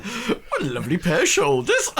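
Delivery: high, playful catchphrase impression; Windsor Davis. Game master obliging friends who asked for him, playful impression. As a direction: put on a comic actor's voice, amused. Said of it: "That's what Windsor Davis".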